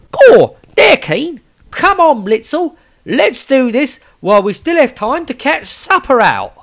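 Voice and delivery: squeaky, eager and goofy; high hammed-up comic wizard; Dapar the fat wizard.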